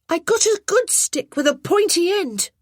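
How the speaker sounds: put-on high, loud pleased little boy; a boy showing off his find; proud boy. A narrator's reading.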